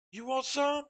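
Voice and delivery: high, loud fighting challenge; crazed brother yelling. Retold or imitated. Brother daring the narrator to fight, wild high yell. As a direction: throw it out like a dare to brawl.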